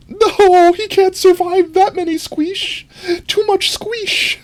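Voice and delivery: very high, squealed protest; hammed-up squeaky wail; mock distress. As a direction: plead in a tiny falsetto. Mock distress over squished Forg.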